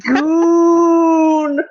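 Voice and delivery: loud long high yell; shouting. Acted. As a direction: belt out the word. Yelled catchphrase.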